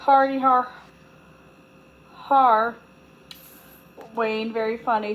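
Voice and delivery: sarcastic snort. A narrator's reading. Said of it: "then she snorted".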